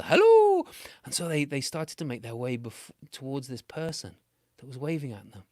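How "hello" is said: very high, loud singsong hello; welcoming holler from a distance; friendly calling out. Shown as a demonstration. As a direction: call out a soaring, harmless greeting. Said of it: "but this was a".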